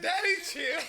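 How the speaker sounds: high, loud imitated plea; lightly voiced son; mock kid voice. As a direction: copy the kid begging.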